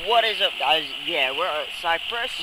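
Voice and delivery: echoey poolside shouting; loud over pool echo; at an indoor pool. Real, not acted. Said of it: "That's dangerous".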